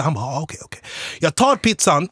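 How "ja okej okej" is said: very soft, quick okays; subdued reply. Retold or imitated. Customer answering about his pizza, soft and subdued. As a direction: give in under your breath.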